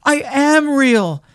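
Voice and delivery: high, slow, loud insistence; wounded high-voiced protest; little rabbit. A narrator's reading. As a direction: insist firmly, drawn out, as a small rabbit. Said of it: "said the little rabbit".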